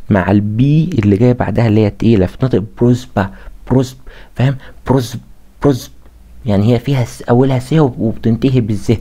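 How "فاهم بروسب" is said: normal talking voice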